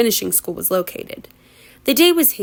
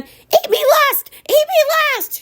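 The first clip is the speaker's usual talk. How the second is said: terrified shriek; 3 dB louder than usual. Acted. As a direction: shriek it in terror. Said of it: "she shrieked".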